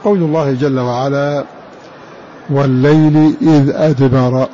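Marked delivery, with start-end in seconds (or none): 2.5-4.5 slow tajwid recitation of the verse; Quran recitation. Acted. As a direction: recite it with care and length. (تلاوة الآية مجودة)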